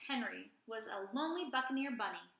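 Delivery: plain reading voice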